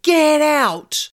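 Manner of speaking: loud, high command; driving the spirit out, loud. Retold or imitated. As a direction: order it out with full force. Banishing the guide, pointing finger.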